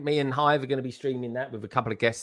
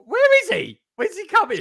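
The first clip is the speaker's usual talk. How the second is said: high-pitched, louder mock bewilderment; exclaimed retold question; 5 dB louder than usual. Retelling own reaction all the way through, high bewildered cry. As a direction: squeal it as a puzzled viewer.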